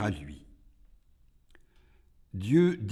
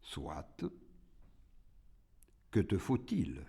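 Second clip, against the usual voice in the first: very low, hushed consent; God's voice; 4 semitones lower, 8 dB quieter than usual. A narrator's reading. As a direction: answer softly from deep down. God answers the demon, hushed.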